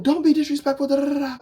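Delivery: high, loud scolding mother; scolding mom. Retold or imitated. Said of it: "she's sitting on the phone".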